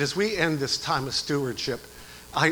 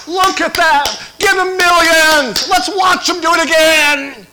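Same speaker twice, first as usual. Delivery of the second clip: higher, louder, amazed shouting; the world cheering a millionaire player; crowd's cheer; 12 semitones higher, 12 dB louder, slower than usual. Pretend. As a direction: roar like a crowd hailing a star. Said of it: "And the world says".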